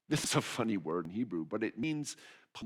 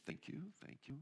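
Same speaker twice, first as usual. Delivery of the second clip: gracias murmuradas, muy suaves; murmured thanks; 5 semitones higher, 16 dB quieter than usual. Real, not acted. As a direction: say it almost under your breath, grateful. Quiet thanks to tree cutters.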